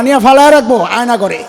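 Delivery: ordinary delivery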